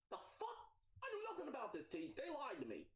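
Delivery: high, fast, outraged outburst; Mia furious. Acted. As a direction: she snaps in shock at the betrayal. Mia furious, much higher.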